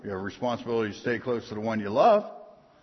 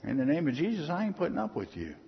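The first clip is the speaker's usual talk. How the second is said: dismissive shut up; 3 semitones higher than usual. Shown as a demonstration. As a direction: wave off the devil's voice. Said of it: "you just look over and say".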